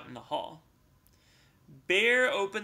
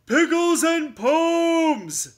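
loud, slow, higher bellow; bear bellowing; 12 semitones higher, 12 dB louder, much slower than usual. Acted. Bear bellowing at Mouse, loud and slow. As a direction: boom it out with glee. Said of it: "bellowed the bear".

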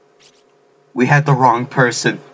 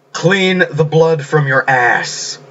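slow, higher-pitched order; drawn-out command; 5 semitones higher, much slower than usual. Acted. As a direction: stretch out each word as a commanding pronouncement. Drawn-out acted command in story.

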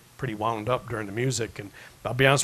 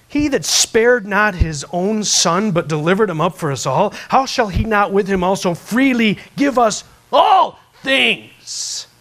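much louder, higher, slow preached scripture; preaching at full force; 7 semitones higher, 10 dB louder, slower than usual. Acted. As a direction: proclaim the verse from the pulpit. Quotes Bible verse preaching.

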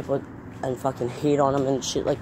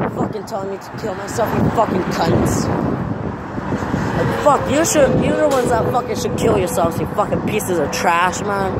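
furious yelling rant; 4 semitones higher than usual. Real, not acted. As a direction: shout them down in real anger. Ranting furiously at online haters.

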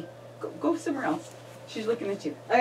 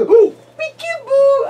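slow, loud, high peekaboo; 13 semitones higher, 9 dB louder, slower than usual. Real, not acted. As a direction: stretch it out with delight. Peekaboo game.

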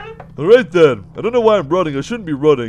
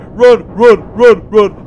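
loud, punched-out repeated word; real, urgent panic; panicked urging; 6 dB louder, slower than usual. Real, not acted. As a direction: urge yourself on in alarm. Fleeing a monster in game.

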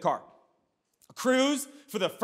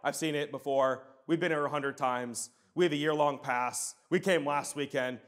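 flat, lower, softer, unimpressed; mock bored preteens; bored kids; 6 semitones lower, 4 dB quieter than usual. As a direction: imitate jaded older boys shrugging it off. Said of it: "And they're like".

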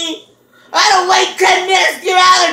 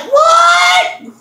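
much higher, startled what; clueless what; 11 semitones higher than usual. Retold or imitated. The friend acting like she noticed nothing, a high startled what. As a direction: play dumb with a high, sudden what. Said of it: "she's like".

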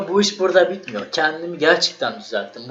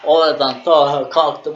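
ordinary talk, nothing marked

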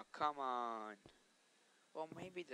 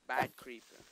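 stern louder telling-off; mock scolding; 8 dB louder than usual. Real, not acted. To the creeper that blew up, a loud mock scolding. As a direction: scold it like a bad dog.

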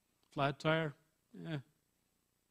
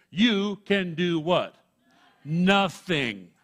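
full-force preaching; 3 semitones higher, 9 dB louder, much slower than usual. Acted. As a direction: thunder the verse out to the congregation. Preacher's question and answer.

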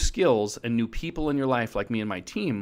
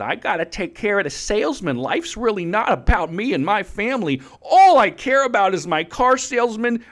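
high, louder whiny mock of a client; 7 semitones higher, 4 dB louder than usual. Mocking the client who puts salesmen first, high and whiny. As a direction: sneer the client's excuses in a pinched higher voice. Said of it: "But you just said".

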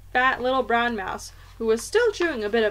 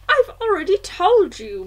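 high-pitched, louder grandmother voice; indignant grandmother; 9 semitones higher, 8 dB louder than usual. A narrator's reading. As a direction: speak up as an affronted old grandmother.

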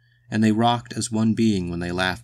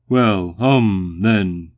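loud, drawn-out hemming; booming walrus; 8 dB louder, much slower than usual. A narrator's reading. The walrus answering Phil's refusal, booming. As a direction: hem loudly like a pompous old walrus.

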